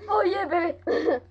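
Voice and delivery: slow Scottish drawl; lightly put-on Scottish accent. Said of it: "That's how the Scottish do it".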